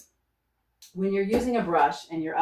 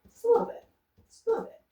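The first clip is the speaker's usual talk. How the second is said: playful sing-song; much faster than usual. Real, not acted. Playful while dabbing paint.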